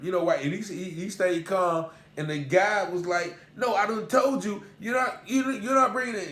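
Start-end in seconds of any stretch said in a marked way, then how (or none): 3.6-6.3 angry bailiff. Retold or imitated. Bailiff refusing again.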